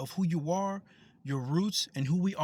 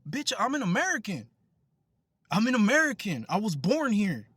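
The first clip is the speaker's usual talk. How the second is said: retelling a proud, heated comeback; retold angry retort; 2 semitones higher, 4 dB louder than usual. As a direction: hammer it home, reenacting the comeback.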